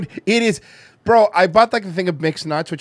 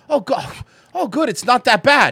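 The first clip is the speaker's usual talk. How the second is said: relieved; 9 semitones higher, 4 dB louder than usual. Retold or imitated. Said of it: "you're like".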